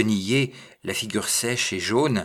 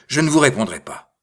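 loud, higher-pitched brusque retort; curt refusal; 6 semitones higher, 9 dB louder than usual. A narrator's reading. Little man defying the bourgmestre's questions, curt and loud. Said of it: "de sa voix cassante".

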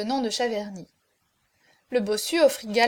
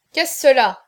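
loud, commanding question; raised, imperious query; sharp demand; 9 dB louder than usual. A narrator's reading. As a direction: demand to know, as a prince would. Prince demands an explanation.

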